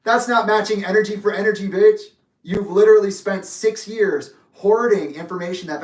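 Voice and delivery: heated, high-pitched accusation; angry rebuke. Real, not acted. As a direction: confront them head-on, loud and emphatic. Angry direct rebuke of absent opponent.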